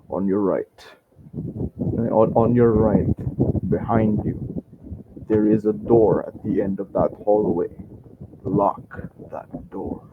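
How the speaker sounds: low, soft, serious order; accent dropped. Acted. Merchant secretly tells guest to lock door, quiet grave voice. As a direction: quietly give instructions, slowing at the end. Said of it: "he loses his, uh, his accent, actually".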